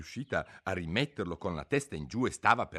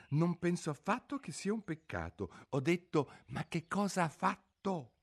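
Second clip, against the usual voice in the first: put-on higher girl's voice; Alice explaining; 9 semitones higher than usual. A narrator's reading. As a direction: correct him like a polite girl. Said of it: "disse Alice".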